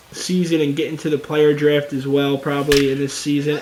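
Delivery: normal talking voice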